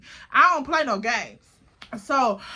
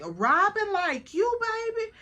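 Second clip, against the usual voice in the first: put-on slow, high, coaxing question to a new girlfriend; drawn-out sugary ask; sweet high question; 8 semitones higher, slower than usual. Aunt sweetly asking if her niece likes her.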